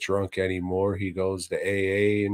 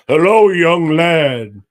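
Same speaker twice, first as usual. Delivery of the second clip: terrible Superman; 7 semitones higher, 10 dB louder than usual. Retold or imitated. Christopher Reeve greeting the boy, a hammed-up hero voice. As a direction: boom it out like a cheesy Superman. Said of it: "that's a terrible Superman".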